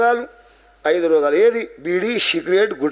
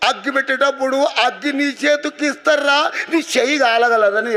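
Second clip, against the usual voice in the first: acted weeping; 9 semitones higher than usual. Mother fearing her son's hand will burn, weeping. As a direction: wail it out high, stretching the phrase ends. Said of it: "ఏడ్చిందట".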